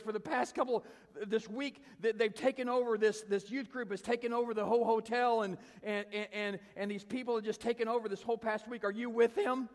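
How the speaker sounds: high, loud, worked-up complaint; imitated high-pitched flustered outpouring; agitated rant. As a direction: pour it out as an upset woman. Retold upset lady's rant.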